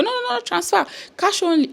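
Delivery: high, brusque refusal in a put-on official voice; mock officer. Officer refusing a bank transfer, brusque high mock voice. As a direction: bat the offer away like a bored border guard.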